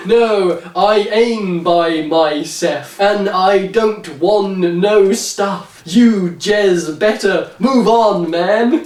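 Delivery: slow, deliberately bad street accent. Podcaster voicing Bill's imitation of a dialect, slow and mocking. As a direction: draw it out in a fake accent.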